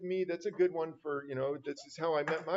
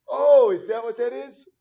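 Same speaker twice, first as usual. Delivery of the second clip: loud, high oh of sudden discovery; surprised realization; 8 semitones higher, 16 dB louder than usual. Real, not acted. Teacher finally decoding a slang heart, surprised.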